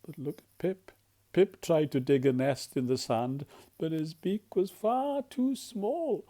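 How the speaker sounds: usual speaking voice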